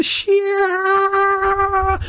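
high, drawn-out squealed word. Pretend. Host riffing on the bully joke, drawn-out squeal.

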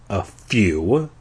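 low, understated grumble; mildly sullen narrated mutter; angry mutter. A narrator's reading. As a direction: answer low, with a touch of anger. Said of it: "I muttered angrily".

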